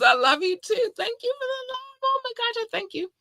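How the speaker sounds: almost crying with joy; emotional squeal. Real, not acted. Host greeting fans from Morocco, high teary gushing. Overwhelmed thanking fans by country.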